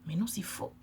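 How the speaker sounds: a soft aside in a whisper. Real, not acted. Correcting herself to viewers in a whisper. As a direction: whisper it to yourself.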